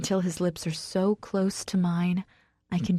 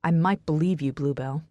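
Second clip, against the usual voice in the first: usual speaking voice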